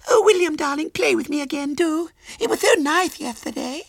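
put-on very high, cloying child voice; pleading little girl. A narrator's reading. Small girl begs William to play, high and coaxing. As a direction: beg sweetly in a tiny squeal.

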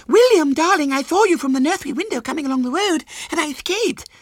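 put-on very high, gushing child voice; excited little girl. A narrator's reading. Small girl runs up to William, high and gushing. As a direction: gabble the news in a squeal.

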